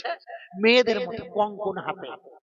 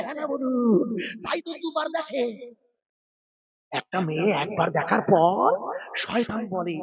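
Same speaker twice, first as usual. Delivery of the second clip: ordinary talk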